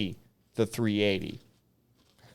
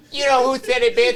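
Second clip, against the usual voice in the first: cheeky taunt; 12 semitones higher, 6 dB louder than usual. Retold or imitated. Taunting the sergeant from the line.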